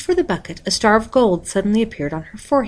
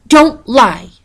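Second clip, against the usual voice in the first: loud, higher sharp scolding; angry rebuke; 6 semitones higher, 9 dB louder than usual. A narrator's reading. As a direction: cut her off with a reprimand. Stepmother snapping at stepdaughter.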